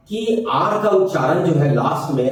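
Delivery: ordinary delivery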